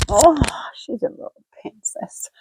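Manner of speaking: high, soft whisper over the dog; hushed, adoring whisper about her dog; tender whisper. Real, not acted. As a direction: breathe it out in a whisper to the puppy.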